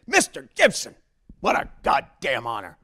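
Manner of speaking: booming hearty big-shot greeting; Babe Ruth voice. Retold or imitated.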